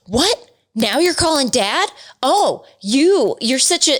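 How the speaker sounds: lightly voiced bratty boy; mocking son. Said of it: "he was like".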